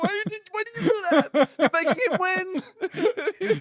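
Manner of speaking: very high, whiny retold protest; mock exasperation. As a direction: plead in comic outrage, stretching the end. Retells furious brother scolding his sister.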